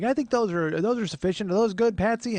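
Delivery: high imitated aunt; aunt's voice. Aunt asking his mom if names suffice, aunt voice. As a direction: fuss and check as the aunt.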